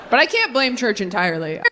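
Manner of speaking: usual speaking voice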